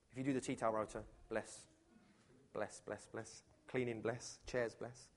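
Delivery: low, hushed mock-consoling asides; soft sympathy. Preacher responds to humble church helpers, softly sympathetic. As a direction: drop right down into little soft blesses.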